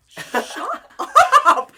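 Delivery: high, loud, laughing protest; giggling mock protest; laughing shut up. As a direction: squeal it through laughter. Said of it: "And I'm like".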